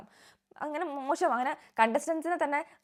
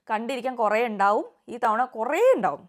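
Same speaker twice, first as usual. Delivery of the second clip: louder, slow and deliberate, leaning on കുറേ; emphatic promise; 7 dB louder, slower than usual. Real, not acted. Vlogger promising viewers plenty to watch, emphatic and slow. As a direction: drive home that there will be കുറേ, lots.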